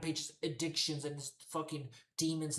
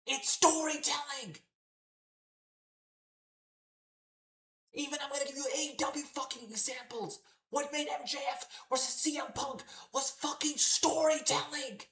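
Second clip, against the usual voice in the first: furious shouting rant, much higher and louder; real exasperated yell; losing it; 11 semitones higher, 5 dB louder than usual. Real, not acted. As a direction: blow up at the company, yelling. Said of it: "I'm losing my shit".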